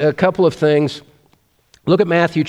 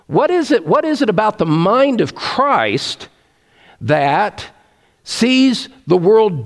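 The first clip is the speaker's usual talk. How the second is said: much higher pitch, urgent questioning; fervent rhetorical question; 6 semitones higher than usual. Real, not acted. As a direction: soar up on the question. Rhetorical question at preaching peak.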